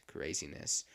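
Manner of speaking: quiet echo. Real, not acted. Repeats to himself as December flies by, quiet echo. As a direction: drop it down to a low afterthought.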